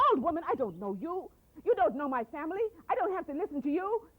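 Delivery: put-on greedy girl scorning the old woman's advice; rude dismissal.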